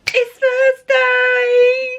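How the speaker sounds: high, slow, drawn-out cheer; sudden excited sing-song. Real, not acted. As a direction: burst out singing the day's name. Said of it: "Don't know where that come from".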